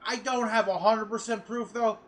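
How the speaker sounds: ordinary talk, nothing marked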